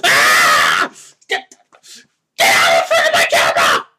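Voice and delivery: low, harsh monster bellow; guttural rage roar. Pretend. Host raging at people blocking his camera, monster roar. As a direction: roar like the Hulk. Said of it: "rage out about that".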